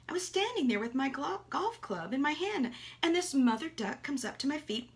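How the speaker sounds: ordinary delivery, nothing marked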